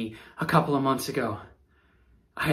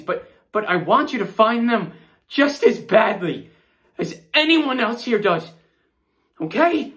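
panicked pleading; 7 semitones higher than usual. Acted. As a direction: beg them to believe you. Suspect pleading innocence, panicking.

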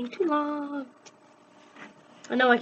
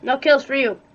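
louder teasing jab; loud taunt; 3 semitones higher, 10 dB louder than usual. Real, not acted. Gamer teasing teammate about kills, loud jab. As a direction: call it out loud to tease.

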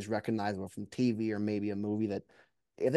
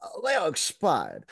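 exaggerated Southern accent; 6 semitones higher than usual. Shown as a demonstration. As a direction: ham up a dramatic Southern gentleman like Detective Blanc. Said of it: "can we hear a little bit?".

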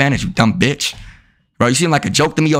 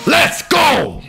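excited shout; 11 semitones higher than usual. Real, not acted. Celebrating a win in game.

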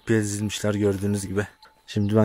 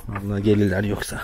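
normal talking voice

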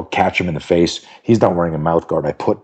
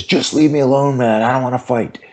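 put-on pleading teenager; 7 semitones higher than usual.

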